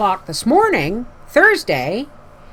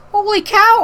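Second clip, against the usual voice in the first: high, louder retold amazement; amazed exclamation; 13 semitones higher, 5 dB louder than usual. Greeting cousins who parked the trailer outside, high amazed surprise. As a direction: exclaim it with pitch way up.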